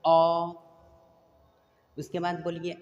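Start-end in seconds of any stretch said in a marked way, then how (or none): 0.1-0.6 अ called out loud for the class; teacher leading recitation; call-and-repeat vowel. Shown as a demonstration. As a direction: sound out अ loudly for pupils to repeat. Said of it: "तो बोलिए आप लोग".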